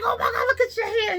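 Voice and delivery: high squealing husband, laughing through it; mock his shrill panic at the swelling; husband's alarm. Husband sees her swollen hand, a high laughing squeal. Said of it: "He was like".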